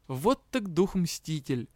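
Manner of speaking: ordinary delivery, nothing marked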